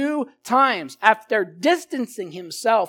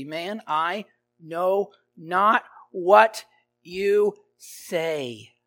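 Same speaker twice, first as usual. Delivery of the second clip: drawn out, slow denial; much slower than usual. Acted. Peter denying to the bystanders, slowly drawn out. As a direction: stretch out the denial.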